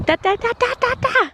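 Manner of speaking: high repeated "da" babble, climbing; imitating babbling toddler. Mum imitating her pointing toddler, high babble. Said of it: "He's going".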